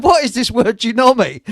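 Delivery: high, loud baffled officer; German officer. Retold or imitated. German officer demanding the meaning of Genomi, loud imitation. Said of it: "captured a senior bloke in the German army".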